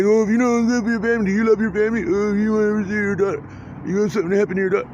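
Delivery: taunting voice twisting his love for family. Retold or imitated. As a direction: needle him like a tormentor. Taunting voices he hears quoted.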